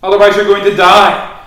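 loud, high warning shout; urgent calling out. Acted. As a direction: call them out of a burning building. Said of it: "I'm calling on you".

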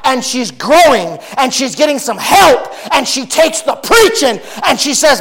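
loud, high preaching, stretched in places; full-force preaching. Acted. Preacher telling the congregation about a woman who grew, full-force preaching. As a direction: drive each line home to the pews.

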